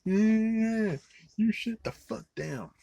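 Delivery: high, loud mocking girl voice; mock yelling girl. Mocking a character yelling at someone. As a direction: squeal it like an annoying girl. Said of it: "like, yelling".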